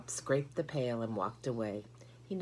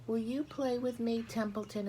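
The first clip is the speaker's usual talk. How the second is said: higher, eager piglet arguing; earnest young pig; 10 semitones higher than usual. A narrator's reading. Wilbur asking the rat.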